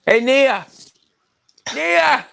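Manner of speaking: loud, high call across a room. Real, not acted. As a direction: shout her name to get attention. Calling out to someone.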